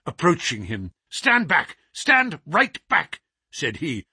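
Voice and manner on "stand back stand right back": raised, imperious order; sharp and commanding. Acted. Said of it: "with the sharp imperiousness".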